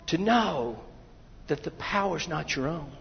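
normal talking voice

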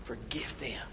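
breathy hush of a dying man; soft, breaking whisper of forgiveness. A narrator's reading. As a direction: whisper it, barely there. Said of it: "Jesus says".

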